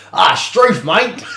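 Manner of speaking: loud, high mock Aussie exclamation; Australian accent. Co-host imitates the butcher on request, hammed-up Australian accent. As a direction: ham up an Australian greeting. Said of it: "Please try and do an Australian accent".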